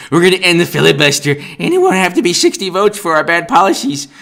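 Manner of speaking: rattling off their plan in mockery; mock boast. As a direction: gloat fast like a smug fool. Mocking opponents' plan.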